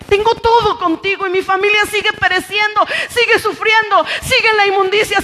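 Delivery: fervent plea to God turning into urgent testimony; impassioned preaching. Real, not acted. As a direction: plead and testify with full pulpit force. (predicación ferviente, como una súplica)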